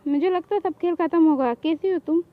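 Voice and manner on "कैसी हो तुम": usual speaking voice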